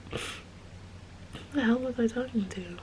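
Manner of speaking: ordinary talk, nothing marked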